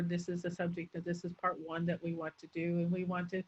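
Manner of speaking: monotone lecturer running the phrases together; droning speaker. Acted. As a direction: drone on with no change of intonation. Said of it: "speakers who drone on".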